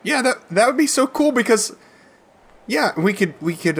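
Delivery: high-pitched, eager stammer; eager kid. Acted. Boy agrees to friends coming over, eager and high.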